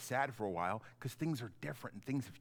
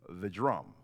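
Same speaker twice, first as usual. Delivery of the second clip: announcing dismissal; slower than usual. Real, not acted. Announcing dismissal to each class.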